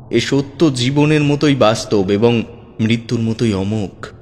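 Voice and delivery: ordinary delivery, nothing marked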